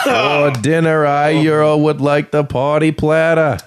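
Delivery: high, slow Kennedy impersonation; JFK impression. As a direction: put on a Boston Kennedy voice. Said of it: "That's the J F K voice".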